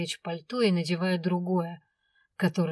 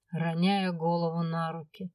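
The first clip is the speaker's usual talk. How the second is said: plain reading voice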